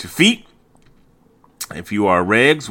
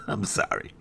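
laughing whisper; 4 semitones higher, 10 dB quieter than usual. Real, not acted. Laughing apology.

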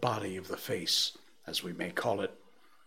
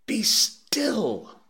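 high, louder urgent plea; imploring; 12 semitones higher, 6 dB louder than usual. A narrator's reading. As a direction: beg him to stop. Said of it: "I implored".

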